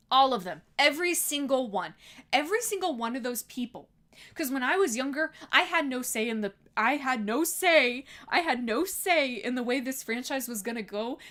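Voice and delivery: loud, emphatic, indignant rant; mad rant. Real, not acted. Streamer railing at old adult fans, mad. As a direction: hammer out each phrase, loud and fed up. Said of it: "I'm just so mad".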